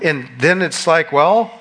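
ordinary talk, nothing marked

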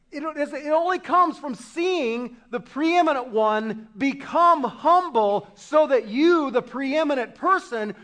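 loud, emphatic pulpit delivery; forceful preaching. Real, not acted. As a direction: drive each phrase home, punching key words hard.

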